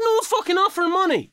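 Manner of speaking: high, fast, indignant cry; high fast retold exasperation; exasperated outburst. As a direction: shriek it in disbelief.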